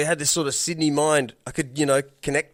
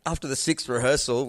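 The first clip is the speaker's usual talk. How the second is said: ordinary delivery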